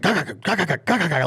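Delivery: quick high clucking chuckle; feed chuckle. Retold or imitated. Buzzing on caffeine during Tim's podcast, high clucking chuckle. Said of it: "like a feed chuckle".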